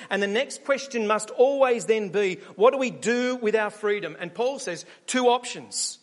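ordinary talk, nothing marked

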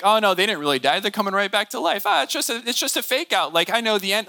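breezy and high, like someone who knows the ending; mock dismissive. Preacher imitating a moviegoer who shrugs off a death, breezy and high. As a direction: wave off the death as a fake out.

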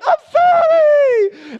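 very high, loud, long mock wail; wailing toddler. Small child after a spank, exaggerated wail. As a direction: imitate a little kid bawling an apology.

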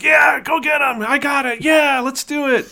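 high, loud cheering shouts; excited whooping. Retold or imitated. Han and Luke whooping in the gun turrets, excited shouting. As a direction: cheer them on like it's a dogfight. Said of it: "'cause they're like".

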